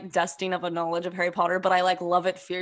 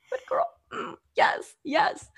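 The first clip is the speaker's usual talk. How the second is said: high-pitched cheering whoop; slower than usual. Retold or imitated. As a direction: squeal it approvingly, way up high. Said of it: "you're like".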